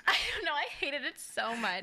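high, laughing protest. Real, not acted. Woman recalling a date she hated, laughing.

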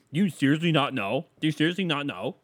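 loud, high mock disbelief; exaggerated, scandalized questioning; mock incredulous. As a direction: imitate a pal aghast at such ignorance. Imitates friends shocked at his ignorance.

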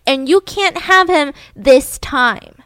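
exploding. Retold or imitated. Betty exploding at Candy over her husband, upset. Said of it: "And Betty exploded".

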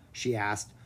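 ordinary delivery, nothing marked

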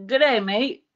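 botched, loud cheesy British accent. Shown as a demonstration. Trying to prove they can do accents, cheesy British accent. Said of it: "like, a cheesy British one".

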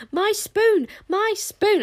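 very high, loud joyful cry. A narrator's reading. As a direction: shriek with jubilant relief. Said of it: "with a cry of joy".

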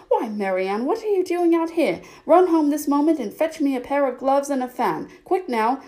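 high, bossy storybook scolding; angry call. A narrator's reading. Rabbit ordering Marianne on an errand, angry. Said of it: "called out to her in an angry tone".

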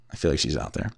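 hushed aside. Real, not acted. Host suspecting someone is listening outside, hushed low aside. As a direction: drop right down as if she might overhear.

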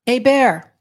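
loud call across to the door. A narrator's reading. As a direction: hail the officer outside, loud and bright. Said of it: "Detective Lacoste calls out".

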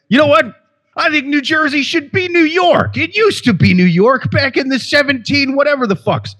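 high, smug mocking voice; mock zealot. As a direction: claim New Jersey like a self-righteous zealot, pitched high. Mock Iowan claiming New Jersey.

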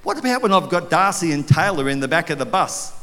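normal talking voice

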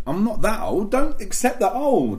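loud, high-pitched indignant protest; drawn-out mock outrage; mock indignation. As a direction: protest loudly at being called old. Mock quarrel over being called old.

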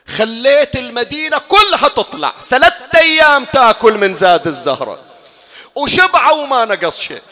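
loud, high mourning sermon; impassioned preaching. Acted. Preacher lamenting Fatima's burial before the mourners, loud and high. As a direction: drive the grief home to the crowd.

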